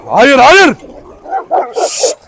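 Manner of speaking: panicked yelling at dogs; alarmed shouting. Real, not acted. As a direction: bark commands to stop the fight. Dogs about to fight.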